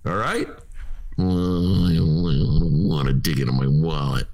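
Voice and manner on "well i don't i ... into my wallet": a hint of a whiny, reluctant tightwad; lightly voiced grumbling miser, only slightly lower; reluctant Darren Bailey imitation. Host imitates Darren Bailey refusing to tip: lightly whiny reluctance. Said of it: "that's my D B not wanting to dig in his wallet imitation".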